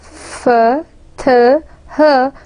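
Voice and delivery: f, th, h as separate sounds; isolated fricative sounds. Shown as a demonstration. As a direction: sound out f, th, h one by one with pauses. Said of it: "A voiceless fricative, for example".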